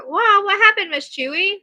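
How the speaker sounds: high, loud startled question; surprised. Real, not acted. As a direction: break off, suddenly taken aback. Reacting suddenly to news in chat.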